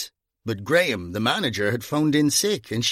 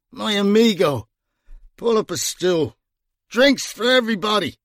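put-on drunken bonhomie; 9 semitones higher than usual. A narrator's reading. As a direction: play the generous drunk. Drunk Gringo hails friend.

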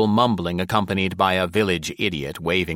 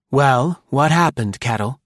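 brisk woman voice; 6 semitones higher, 5 dB louder than usual. A narrator's reading. Maud voiced by narrator.

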